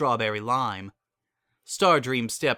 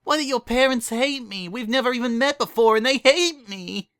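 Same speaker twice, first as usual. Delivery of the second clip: high, louder tearful whimper; higher, louder whimper; 12 semitones higher, 5 dB louder than usual. Acted. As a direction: wail it out, hurt and bewildered. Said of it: "she whimpered".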